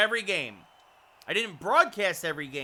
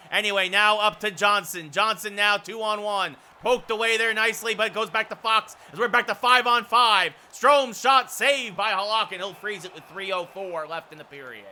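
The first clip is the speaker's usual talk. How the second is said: play-by-play; 5 semitones higher, 8 dB louder than usual. Real, not acted. Announcer calls Strome's shot saved, lively play-by-play. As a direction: lively call peaking on the shot.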